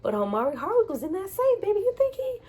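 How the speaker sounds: high, eager questions to daughter; hopeful excitement. Retold or imitated. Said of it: "I was like".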